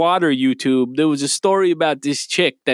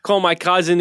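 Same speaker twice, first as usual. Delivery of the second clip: ordinary talk, nothing marked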